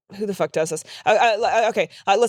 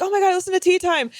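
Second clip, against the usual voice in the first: gushing fan; 10 semitones higher than usual. Retold or imitated. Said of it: "being really sweet".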